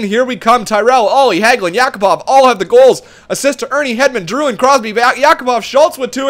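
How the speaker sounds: normal talking voice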